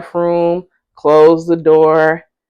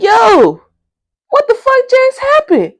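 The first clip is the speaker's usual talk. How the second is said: high, incredulous outburst; stunned disbelief; 4 dB louder than usual. Retold or imitated. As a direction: sound totally thrown by what she said. Shocked by her rude exit.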